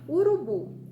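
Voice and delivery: Urubu called out loud, final bu stressed; clear demo word. Shown as a demonstration. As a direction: project Urubu loudly, leaning on the last syllable bu. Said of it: "a última letra é o U".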